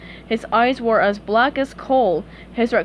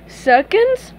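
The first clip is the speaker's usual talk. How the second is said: bright-eyed offer, high and louder; eager suggestion; 10 semitones higher, 5 dB louder than usual. Acted. Patrick suggests more ice cream to SpongeBob, eager. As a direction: pitch it up as a hopeful suggestion.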